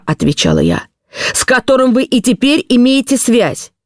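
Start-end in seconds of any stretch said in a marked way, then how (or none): 1.3-3.7 slow question at a much higher pitch; tense, deliberate accusing demand; stern interrogation. A narrator's reading. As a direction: drive the accusation home relentlessly. Husband's final accusing question.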